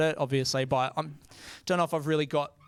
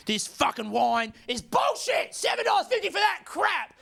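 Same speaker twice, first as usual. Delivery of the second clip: put-on screaming drunk woman; 12 semitones higher than usual. Woman on the train screaming at staff over wine, furious high yell. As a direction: bellow it like a drunk passenger furious about her wine. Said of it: "She's screaming at them".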